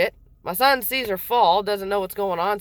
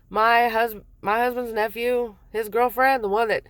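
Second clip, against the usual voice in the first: ordinary delivery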